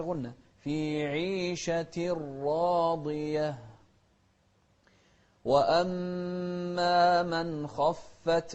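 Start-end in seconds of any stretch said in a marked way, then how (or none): 5.4-8.5 من خفت with a plain, clear nun. Shown as a demonstration. Teacher chants slowly, showing a clear nun. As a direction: sound the nun in من خفت fully. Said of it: "إظهار".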